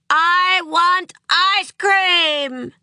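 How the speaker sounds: put-on high, slow, loud child's wail; a furious little girl howling for ice cream; howled demand. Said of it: "howled Ruby".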